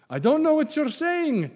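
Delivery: very high-pitched protest; exaggerated denial. Peter's denial replayed, acted.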